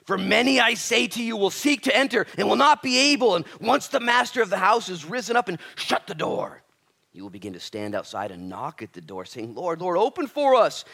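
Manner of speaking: loud, high, ringing sermon voice; full-force preaching. Acted. Preacher driving the point home to his congregation, loud and high. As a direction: preach it out to the whole room.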